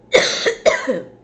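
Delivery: loud, hard cough; real, forceful coughing burst; real coughing fit. Real, not acted. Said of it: "take care of my cough".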